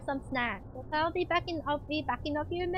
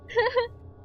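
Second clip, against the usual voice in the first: a quick high giggle; short high giggle; 9 semitones higher than usual. Real, not acted. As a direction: giggle at yourself. Laughs at herself.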